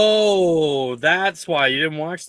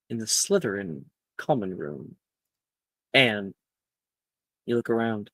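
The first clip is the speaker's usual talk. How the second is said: game master's scene setting; 4 semitones lower, 7 dB quieter than usual. Acted. Game master opens a late-night common room scene, hushed narration.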